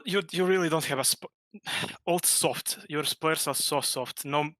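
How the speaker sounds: normal talking voice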